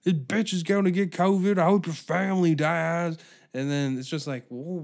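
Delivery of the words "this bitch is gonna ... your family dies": loud, raised-pitch cursing; yelling. Retold or imitated. Man being kicked out curses the woman, yelling. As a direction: spit curses like an enraged customer. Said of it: "Now he's yelling at her".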